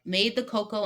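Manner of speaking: usual speaking voice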